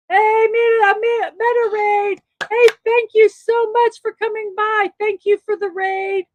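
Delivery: high, loud excited welcome to the raiders; excited greeting. Real, not acted. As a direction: call out a thank-you to the raiders, beaming. Streamer greets incoming raiders.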